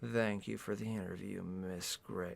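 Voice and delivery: low, very soft suave man; hushed leading-man voice; man's character voice. A narrator's reading. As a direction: murmur the thanks in a deep hush. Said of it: "Oh, that's her speaking!".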